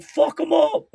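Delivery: loud, high shout egging on a fight; hammed-up dad yelling from the crowd; mock shout. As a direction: bellow it like a dad at ringside. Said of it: "My dad's gonna be like".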